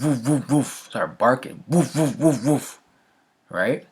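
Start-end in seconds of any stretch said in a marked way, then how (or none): 0.0-0.9 mock barking, a little higher; dog bark imitation. 1.7-2.8 mock barking, a little higher; dog bark imitation.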